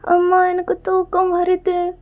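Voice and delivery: put-on slow, drawn-out childish whine; acted drowsy kid voice; sleepy little child. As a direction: whine sleepily like a small boy. Sleepy crying child to mother.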